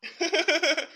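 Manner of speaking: high, giddy little laugh; delighted chuckle. Acted. Delighted at daughter-in-law's tea offer.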